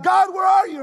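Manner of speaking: high, loud desperate shout; shouting where are you to heaven; frustrated cry to God. Retold or imitated. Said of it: "you get frustrated, like".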